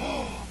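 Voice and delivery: hushed gasp of awe; awed gasp. Retold or imitated. Townspeople gasping in awe at the passing priest. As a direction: gasp quietly like an impressed crowd. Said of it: "the people would go".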